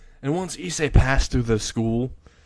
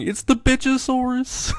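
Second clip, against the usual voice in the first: panicked cry; 13 semitones higher than usual. Acted. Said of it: "they all started running, saying".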